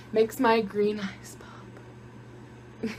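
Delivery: slow, breathy mock-creepy whisper. Vlogger whispers a creepy joke to viewers. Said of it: "That was probably really creepy".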